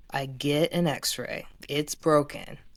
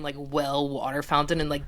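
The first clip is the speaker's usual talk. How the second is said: normal talking voice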